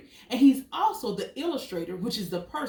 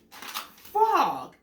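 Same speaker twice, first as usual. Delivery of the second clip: excited reveal; 8 semitones higher, 4 dB louder than usual. Acted. Gasping reveal of answer.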